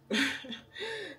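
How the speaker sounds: short high laugh; quick high-pitched chuckle. Real, not acted. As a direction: break into a brief, real laugh. Laughs about the sauce.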